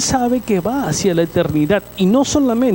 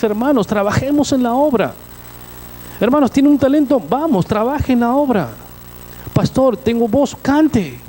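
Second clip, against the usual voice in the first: raised, fervent exhortation to the flock; preaching at full force; 5 semitones higher than usual. Acted. Pastor urging the congregation to serve, fervent preaching. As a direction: push the brothers to get to work, voice lifted high.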